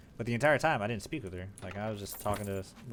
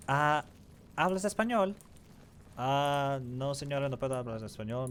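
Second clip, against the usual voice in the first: high, bright phone greeting in rehearsed Spanish; phone agent Spanish; 5 semitones higher, 4 dB louder than usual. Retold or imitated. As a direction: answer the call like a polite agent. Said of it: "That's all I can say".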